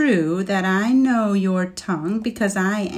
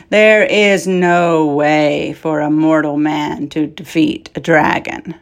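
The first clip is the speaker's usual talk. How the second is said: retorting dragon; 3 semitones lower, 7 dB louder than usual. Acted. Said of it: "retorted Scorch".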